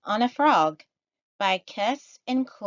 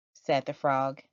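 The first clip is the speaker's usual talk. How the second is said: usual speaking voice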